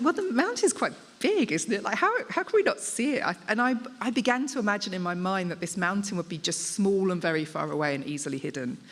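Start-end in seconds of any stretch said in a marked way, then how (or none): 0.0-3.2 incredulous question. Retold or imitated. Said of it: "I said to my husband".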